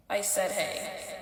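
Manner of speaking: imitating a roommate's curt cold hello; flat cold greeting. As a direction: brush him off in a bored, slow monotone. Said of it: "The same tone I just said".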